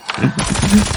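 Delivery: high-pitched laugh. Real, not acted. Laughs at own config joke.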